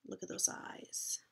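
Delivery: hushed spooky whisper; whispering about eyes behind the fence. A narrator's reading. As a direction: breathe it like a secret. Spooky aside in story.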